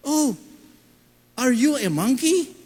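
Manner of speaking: playful mock question; teasing. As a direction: poke fun at her. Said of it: "Susubukan ko lang".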